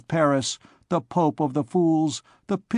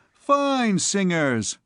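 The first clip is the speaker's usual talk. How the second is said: sarcastic; 6 semitones higher, much slower than usual. Acted. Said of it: "replied the other".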